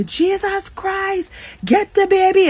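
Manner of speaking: high-pitched Trinidadian cry of alarm; Trini accent. Retold or imitated. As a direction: imitate a panicked Trinidadian shout, stretched out. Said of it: "this is a Trini accent".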